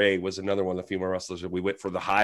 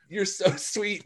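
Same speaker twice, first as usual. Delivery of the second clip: high, soft cooing; a hint of a cooing wrestler; sweet reply; 10 semitones higher, 4 dB quieter, slower than usual. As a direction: coo it back sweetly. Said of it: "And she's like".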